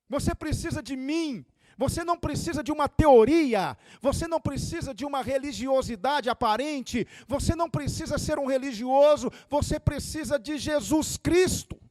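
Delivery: high, loud preacher's cry; full-force preaching. Acted. Preacher urging the woman and hearers to need Jesus, high and ringing. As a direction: preach it out to the crowd.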